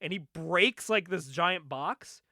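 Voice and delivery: usual speaking voice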